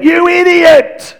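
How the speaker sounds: high, drawn-out outraged shout; angry yell. Acted. Driver yelling at someone who almost smashed the car, outraged yell. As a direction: yell it out, voice soaring and stretched. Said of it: "can't help but yell out".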